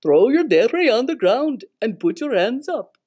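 firm, loud agent command. Acted. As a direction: order them to surrender. Agents order arrest.

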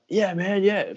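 hammed-up over-keen agreement; overeager fan. What he avoids saying to fans asking for photos, mock overeager. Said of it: "you don't wanna be like".

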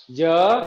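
Giờ with the final vowel pitched high and held long; high drawn-out ending. Shown as a demonstration. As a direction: end Giờ up high and stretch it. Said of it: "Cuối từ chúng ta đọc cao và kéo dài".

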